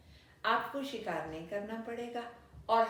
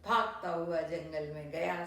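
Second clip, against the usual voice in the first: ordinary delivery, nothing marked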